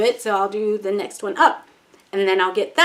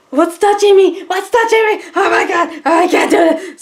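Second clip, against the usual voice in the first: much higher, louder mock panic; panicked shriek; 10 semitones higher, 7 dB louder than usual. In the spring pool feeling plants underfoot, panicked high shriek. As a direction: squeal like something grabbed your leg. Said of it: "I'm like".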